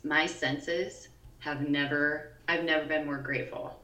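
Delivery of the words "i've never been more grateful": ordinary talk, nothing marked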